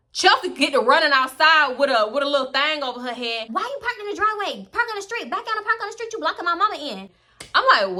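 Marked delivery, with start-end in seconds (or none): 3.5-7.1 high-pitched imitation of an irritated friend; mock irritated orders, pitched up. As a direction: fuss at them in a high voice. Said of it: "she was kinda irritated".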